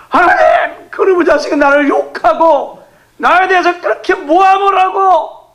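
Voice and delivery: high, loud, half-laughing wail to God; preacher mimicking a wronged believer's complaint; aggrieved crying prayer. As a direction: cry out your grievance, over the top. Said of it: "저는 진짜 억울하면 막 그렇게 기도해요".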